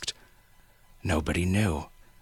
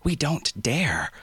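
slow, higher, tense worry; nervous warning; 8 semitones higher, slower than usual. Acted. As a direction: say it with dread, slowly. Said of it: "Vaughn was sweating".

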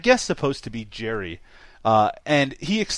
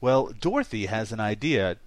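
usual speaking voice

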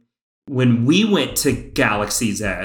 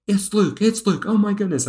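retold excited scream; 9 semitones higher than usual. Fan telling how he saw Luke arrive, mock screaming. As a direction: squeal it like a thrilled fan, held back in volume. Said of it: "I am screaming".

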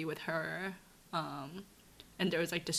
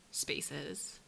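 normal talking voice

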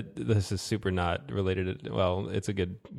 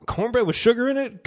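very high, indignant imitated question; mock incredulous; 14 semitones higher, 4 dB louder than usual. Southern friend answering the narrator, high mock disbelief. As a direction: squeal it like an affronted cornbread purist.

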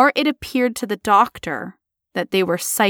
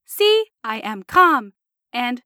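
slow, deliberate robot delivery; 5 semitones higher than usual. Acted. Patient reporting neutrally and factually to the doctor, robotic voice. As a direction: report it like a machine, syllable by syllable. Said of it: "I'll try it again as a robot".

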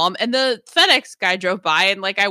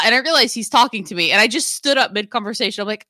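normal talking voice

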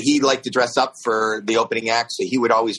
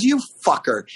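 mock outrage; 6 semitones higher than usual. Retold accusation on discovering the prank.

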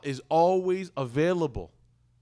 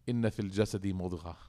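recitation; 7 semitones lower, 6 dB quieter, much faster than usual. Acted. Reciting hadith in Arabic.

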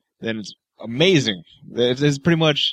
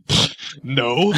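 dismissive pfft. Retold or imitated. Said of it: "You did one like".

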